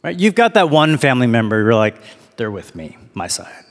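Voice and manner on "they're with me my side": low, soft private thought; confiding aside. Acted. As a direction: say it under your breath, as if claiming an ally. Voicing thoughts about a family ally.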